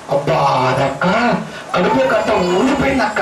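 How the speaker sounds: a hint of a mock testimony voice; mimicked believer. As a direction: draw out the first words, mimicking a believer. Mimics a believer claiming a healing.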